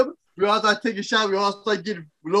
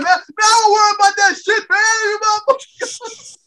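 mock yell; 9 semitones higher than usual. Voicing himself yelling at Derek.

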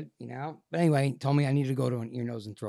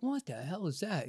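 high, soft "what the hell"; quietly startled, voice jumping up; puzzled alarm; 7 semitones higher, 7 dB quieter than usual. Retold or imitated. Man spotting a lump in his mirror, puzzled alarm.

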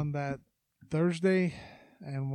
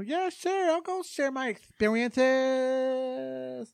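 high, drawn-out put-on woman's voice; hammed-up high voice, stretching the last word; imitating how she talks; 9 semitones higher, 6 dB louder, slower than usual. Host mimics a woman agreeing to come on the podcast, hammed-up high voice. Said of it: "talking like that's how she talks".

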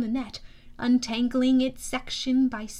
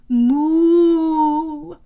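mock moo; 4 semitones higher, 10 dB louder than usual. Baby cow serpent moos.

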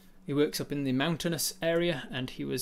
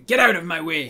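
shouted, higher get out of my way; loud shove-off; 6 semitones higher, 12 dB louder than usual. Acted. Tyrant shouting at a prisoner to move. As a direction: bark it as you push him off.

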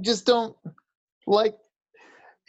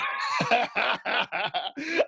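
laughing; 4 semitones higher, 5 dB quieter than usual. Real, not acted. Laughing at own broke joke.

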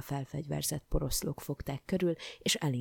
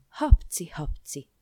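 resounding sneezes; 9 dB louder, slower than usual. A narrator's reading. King still ill after every cure, resounding sneezes. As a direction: sneeze the words out, first one biggest. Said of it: "tüsszögött, csak úgy zengett".

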